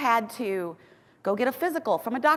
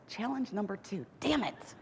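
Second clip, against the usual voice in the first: much softer, under-the-breath vow; muttered to self; 11 dB quieter than usual. Retold or imitated. After the lawyer's taunt, muttering softly to herself. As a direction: grumble it privately, with grit.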